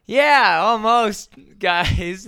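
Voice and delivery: loud, high, laughing reply; excited shout. Retold or imitated. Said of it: "And I'm like".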